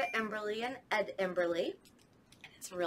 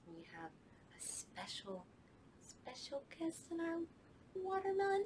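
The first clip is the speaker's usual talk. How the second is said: whisper; 7 semitones higher, 11 dB quieter than usual. Acted. Secret guest reveal.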